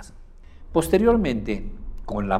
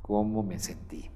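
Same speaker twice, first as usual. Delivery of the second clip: a touch lower and quieter, drawn out; slow, low reply; 6 dB quieter than usual. Shown as a demonstration. Client on the phone answering, slow and low. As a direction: answer softly as someone feeling it. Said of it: "notaste una velocidad lenta".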